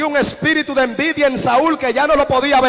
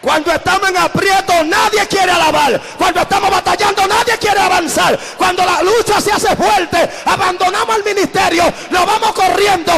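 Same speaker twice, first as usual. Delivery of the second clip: preaching at full force; 5 semitones higher than usual. Acted. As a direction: drive the congregation to fight. Preaching climax.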